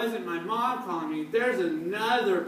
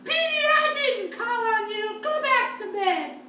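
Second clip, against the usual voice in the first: high-pitched mother voice; high mom voice; 5 dB louder than usual. Acted. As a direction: play the sleepy mom, voice up high.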